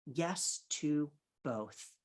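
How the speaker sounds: "Yes to both" at half speed; deliberate, drawn-out "Yes to both"; slow, emphatic. Real, not acted. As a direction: space out each word of "Yes to both". Repeats it slowly for emphasis.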